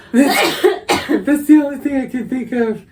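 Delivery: high, loud, squeezed out through laughter; can barely talk for laughing; laughing hard. Real, not acted. Co-host excusing the terrible impression, helpless laughter. Cracking up after own impression.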